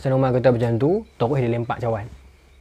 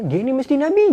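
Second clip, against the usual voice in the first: higher and louder in awe; awed exclaim; 11 semitones higher, 5 dB louder than usual. Acted. Nobleman declaring the caliph must be a prophet, awed and loud. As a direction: blurt out the marvel.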